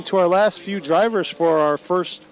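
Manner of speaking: usual speaking voice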